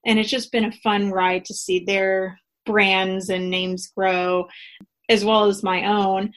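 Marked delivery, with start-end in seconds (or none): none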